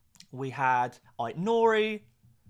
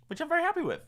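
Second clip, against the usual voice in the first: high silly voice; 14 semitones higher, much faster than usual. Acted. Thanking viewers, pitch jump.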